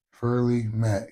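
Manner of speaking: ordinary talk, nothing marked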